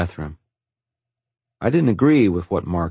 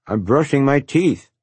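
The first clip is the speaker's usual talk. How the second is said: garbled through a toothbrush; talking around a toothbrush; mouth full; 7 semitones higher, 3 dB louder than usual. A narrator's reading. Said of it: "I garbled out".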